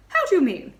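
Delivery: astonished. Acted. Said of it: "She stammered".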